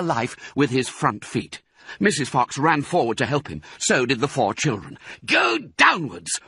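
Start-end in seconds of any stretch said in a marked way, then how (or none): 5.2-6.4 slow, high, commanding call; urgent order. A narrator's reading. Mister Fox directing his digging family, urgent order. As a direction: order it with drawn-out emphasis. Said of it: "ordered Mister Fox".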